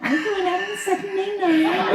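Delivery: put-on high whiny Liverpool girl voice with a laugh; whiny Scouse. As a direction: whine it like a Scouse girl off out for the night. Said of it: "they're whiny".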